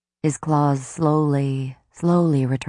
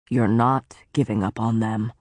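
lower, grave warning in a lord's voice; lower stern faerie lord; 5 semitones lower than usual. Acted. Tamlin warning Feyre of the woods, low and grave. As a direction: warn her darkly, as the brooding lord.

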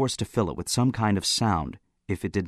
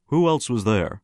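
ordinary delivery

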